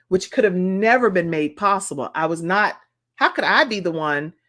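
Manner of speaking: normal talking voice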